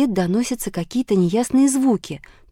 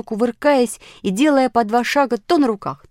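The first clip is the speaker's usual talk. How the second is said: plain reading voice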